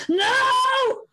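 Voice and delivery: put-on high shrill child's no; child's scream. Boy refusing the iPad again, screaming high. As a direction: scream it like a stubborn toddler.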